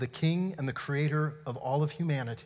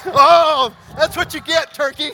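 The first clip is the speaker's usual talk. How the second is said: high, loud playful jeer; teasing shout, much higher than usual; mock taunt; 12 semitones higher, 16 dB louder, slower than usual. Real, not acted. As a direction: crow it out high and loud after the chase. Mock taunt after running demonstration.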